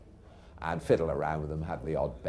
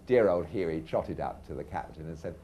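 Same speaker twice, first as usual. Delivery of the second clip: normal talking voice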